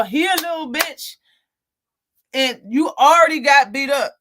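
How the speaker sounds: ordinary talk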